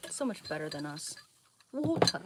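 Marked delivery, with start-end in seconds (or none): 1.7-2.2 water said British, wɔltə, higher with a rise; "Water." in a lifted British accent. Shown as a demonstration. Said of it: "British people say water".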